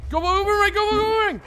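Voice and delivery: very high, loud urging; urgent shout. Real, not acted. Gamer urging the magic boomerang on, urgent shout. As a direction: yell it on like a frantic cheer.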